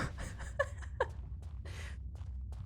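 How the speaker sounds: quiet, breathy laugh. Real, not acted. Streamer amused by their own accent attempt, breathy laughing. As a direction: laugh softly and helplessly.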